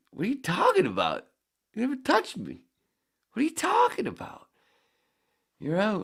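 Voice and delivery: high, loud outraged yelling; retold shouting argument. Kid arguing the call with the umpire, loud and outraged. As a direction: yell at the umpire in disbelief. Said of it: "And I turn around".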